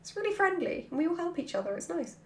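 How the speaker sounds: ordinary talk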